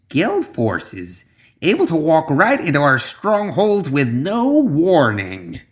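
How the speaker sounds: lightly voiced scheming witch, measured; a touch of a slow ominous seer; Zoraida voice. A narrator's reading.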